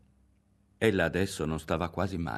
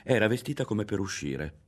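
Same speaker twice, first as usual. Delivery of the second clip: plain reading voice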